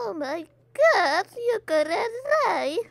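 a hammed-up crying complaint; mock crying. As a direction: whimper it in fake dismay.

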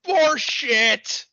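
high, loud scornful shout; imitating John's outburst; mock shout. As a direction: bark it out scornfully. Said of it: "John's just like".

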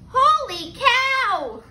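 high, slow, drawn-out exclamation; astonished pig. A narrator's reading. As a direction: stretch it out in amazement. Pig spots the disguised turkey.

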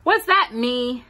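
put-on a boy hardly daring to believe; awed. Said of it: "in an awed voice".

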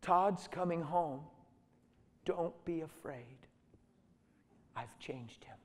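voice of the Lord. Retold or imitated. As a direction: reassure slowly, letting each phrase settle. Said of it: "the Lord said".

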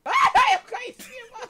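high shriek breaking into laughter; laughing scream. Real, not acted.

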